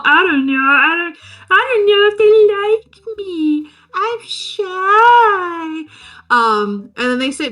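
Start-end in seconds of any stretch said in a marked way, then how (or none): 0.0-5.8 high, drawn-out hammed-up whine; exaggerated, high-pitched shy whimper; whiny shy guy. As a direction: wail it bashfully, stretching the words. Voices shy character fretting about call.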